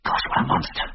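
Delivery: breathless hushed gasp of awe; awed whisper. A narrator's reading. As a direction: whisper it, staring at the beast. Whispering at sight of bear.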